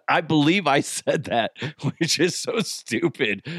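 normal talking voice

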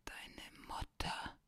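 very slow, faint, wheezing; sinister slow caller. Acted. Prank caller answers Dominik with a slow wheezing threat. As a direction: stretch it out like a threat. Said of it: "mit allerletzter Kraft zu sprechen".

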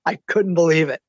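usual speaking voice

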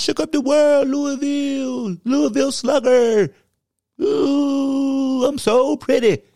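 Petty Hawk persona. Acted. Host's Petty Hawk persona gloating over penalties, high and cocky. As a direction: strut it like a show-off bird.

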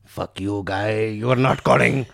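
low mock menace, building in level; hammed-up scammer getting mad; mock angry scammer. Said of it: "They get mad at you".

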